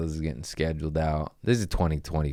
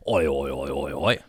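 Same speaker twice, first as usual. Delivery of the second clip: lightly put-on Australian chant, lower pitched; mock Australian; 5 semitones lower than usual.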